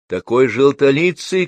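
high, loud, drawn-out shout. A narrator's reading. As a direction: call it out across a noisy room. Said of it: "крикнула тётя Груша".

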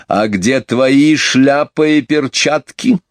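rapping out each word, high and slow; clipped out. A narrator's reading. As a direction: chop every word precisely. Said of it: "отчеканила". (отчеканивая каждое слово)

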